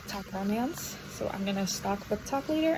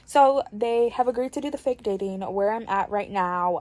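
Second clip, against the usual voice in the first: ordinary talk, nothing marked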